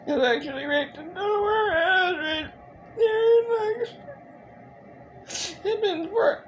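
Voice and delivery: very high, tearful voice breaking down; high-pitched sobbing after a long silence; real crying. Real, not acted. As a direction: struggle to get the words out through tears.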